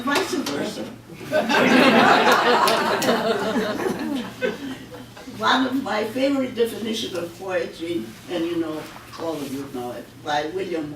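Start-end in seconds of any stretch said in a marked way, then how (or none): 0.9-5.3 a real bright laugh. Real, not acted. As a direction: let the laugh ring out. Audience-facing laugh.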